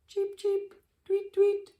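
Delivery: very high chirping birdsong; bird chirps. Acted. Birds chirping up in the trees, high chirps. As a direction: chirp like tiny chicks.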